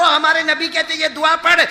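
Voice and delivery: usual speaking voice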